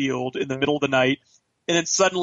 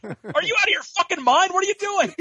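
much higher, squeaky disbelief; shocked agents. Retold or imitated. Agents react to Bauer's hacksaw, high shocked squeal. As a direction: shriek it like a stunned bystander. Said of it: "And they're like".

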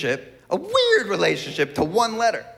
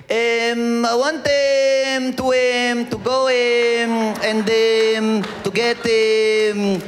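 very slow mock Israeli accent with long droning ums; comic Israeli accent, stretched hesitations; 5 semitones higher, much slower than usual. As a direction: hang on every "um", Israeli-style. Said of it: "Israelis, it's the letter M".

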